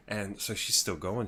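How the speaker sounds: usual speaking voice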